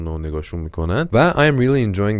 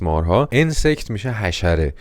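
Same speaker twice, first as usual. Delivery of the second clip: usual speaking voice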